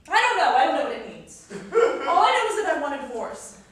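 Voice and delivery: exasperated, loud cry; frustrated outburst. Acted. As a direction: burst out helplessly at your spouse. Wife bursts out in quarrel.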